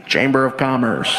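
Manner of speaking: low, deadpan official voice; mock stiff official. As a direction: play it straight as the prankster. Prank official deep voice.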